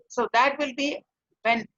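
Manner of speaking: ordinary talk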